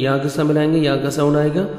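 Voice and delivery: ordinary delivery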